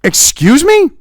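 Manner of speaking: offended double take; incredulous. Real, not acted. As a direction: balk at what you just heard. Reacting to a clip's claim.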